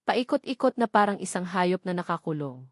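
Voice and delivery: usual speaking voice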